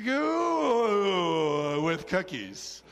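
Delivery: hugely drawn-out, hammed-up "You"; mock swooning. Pastor inventing Emma's reply to Carrie, mock swooning. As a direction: stretch it out like a comic gush. Made-up gushing reply of Emma.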